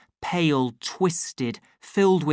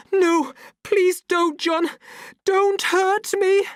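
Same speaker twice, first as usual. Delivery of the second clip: terrified plea; 7 dB louder, slower than usual. A narrator's reading. Lenina cowering, covering her face.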